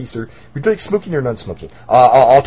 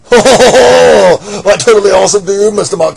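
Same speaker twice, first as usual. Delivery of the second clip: loud surfer dude; 4 semitones higher, 11 dB louder than usual. Acted. Surfer customer loudly greeting Mister Monkey. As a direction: boom it like a party dude.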